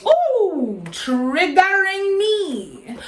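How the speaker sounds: high, long-drawn sing-out; mock triggered wail. Acting out being shouted at in her face, mock wail. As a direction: stretch it out high, hammed-up.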